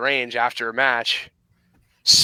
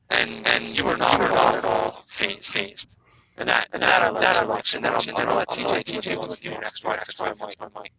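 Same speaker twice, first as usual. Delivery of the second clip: glitchy robotic mic distortion; 4 semitones lower, 4 dB quieter than usual. Real, not acted. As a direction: voice breaking up like a bad robot connection. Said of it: "Kent's microphone miraculously turns into a robot".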